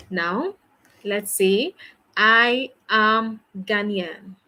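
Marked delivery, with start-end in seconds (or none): none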